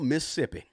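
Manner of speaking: Mississippi squeezed to Missippi, middle syllable dropped; Southern Mississippi. Shown as a demonstration. Host showing viewers the local way to say the state, clipped Missippi. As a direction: say Mississippi as three quick syllables, Miss-ip-pi. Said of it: "You gotta say it like that".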